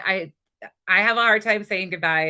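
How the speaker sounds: normal talking voice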